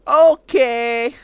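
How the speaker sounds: high, loud, overly cheerful okay; chipper AI. Retold or imitated. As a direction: chirp it like an eager robot. AI cheerfully agrees.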